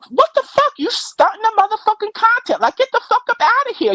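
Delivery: high, fast, worked-up telling off; exasperated rant. Real, not acted. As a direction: blast them, fed up. Ranting at rival streamers.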